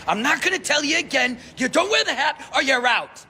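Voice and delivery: high, loud mock bailiff yell. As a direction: bark it like a fed-up bailiff. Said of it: "he's like ... And he, like, yelled".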